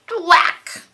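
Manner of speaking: loud high thwack of falling blocks; loud crash sound. Acted. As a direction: smack the sound out. Tower knocked over.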